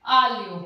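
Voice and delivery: आलियो called out loud, aa-li-yo; loud pronunciation demo. Shown as a demonstration. Teacher calling out आलियो for her class. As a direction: hit आलियो loudly with a clear ल्य. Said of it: "जीएल के बाद में फिर ई आ गया".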